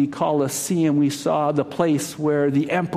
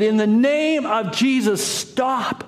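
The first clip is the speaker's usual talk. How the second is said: crying out; 8 semitones higher, slower than usual. Acted. Monk shouting in the arena.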